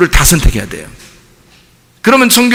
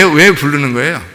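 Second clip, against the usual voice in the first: ordinary talk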